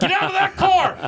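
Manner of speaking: high, loud shout through laughter; laughing mock shout. Teasing Andy about being pulled from a car, laughing shout. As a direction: yell it like a cop, cracking up. Mocking cops pulling him from car.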